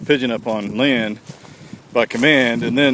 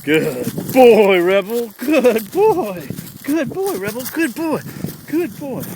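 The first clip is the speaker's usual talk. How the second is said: gushing praise; 11 semitones higher, 4 dB louder, much slower than usual. Real, not acted. Trainer rewarding his dog after a retrieve, gushing and high. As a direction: pour on the praise, climbing higher.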